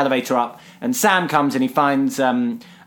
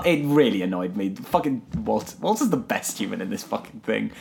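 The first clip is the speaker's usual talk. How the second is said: ordinary delivery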